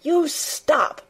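high, furious command; almost shouting. A narrator's reading. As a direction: repeat the order as a near-shout. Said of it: "she almost shouted".

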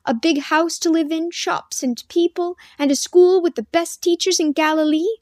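lightly voiced girl pressing her point; insistent demand. A narrator's reading. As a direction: list it off as a challenge. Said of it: "forgetting Daniel in her insistence".

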